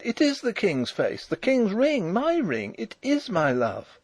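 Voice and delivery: high-pitched, impassioned insistence; crying out. A narrator's reading. Princess recognising her beloved's face and ring, crying out. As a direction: insist in a high, rising cry. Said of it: "she cried".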